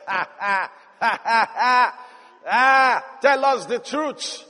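mock laughter into a drawn-out taunt; jeering inmates. Inmates scoff at Joseph's claim, mocking laughter. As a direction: jeer in disbelief, mockingly clapping back. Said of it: "they must have clapped and said".

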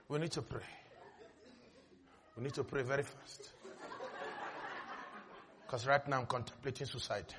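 very soft, low, despairing mock whisper; a scared patient mumbling to the pastor; frightened patient. Patient begging the pastor for prayer, in a very soft fearful voice.

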